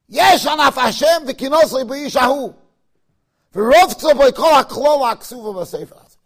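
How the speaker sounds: loud ringing recital; declaimed verse. Acted. Rabbi repeating the verse to scare his class, loudly. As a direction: proclaim the words with force.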